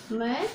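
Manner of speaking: मैड sounded out with an "ai" vowel; मैड, vowel held before the final d; correct MAD pronunciation. Shown as a demonstration. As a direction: model मैड slowly for pupils, like English "mad". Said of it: "क्या बोलोगे".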